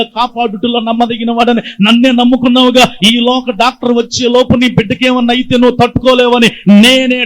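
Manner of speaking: preaching God's voice. Acted. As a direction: rise into a triumphant proclamation. God's voice rising in fervour.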